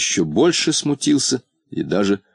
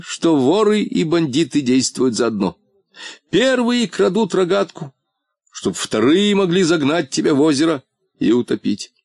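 higher and excited, building suspicion; Polbotinka, suspicious and worked up; 8 semitones higher than usual. Acted. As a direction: let the suspicion run away with you. Polbotinka voices his suspicion.

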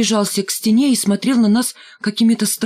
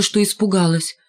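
plain reading voice

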